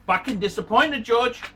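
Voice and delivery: normal talking voice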